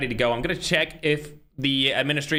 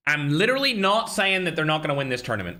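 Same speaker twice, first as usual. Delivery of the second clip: ordinary delivery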